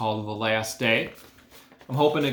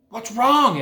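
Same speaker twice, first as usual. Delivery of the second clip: higher, louder sudden alarm; alarmed announcer; 11 semitones higher, 7 dB louder than usual. A narrator's reading. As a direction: call out in a startled announcer voice. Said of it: "asked the loudspeaker".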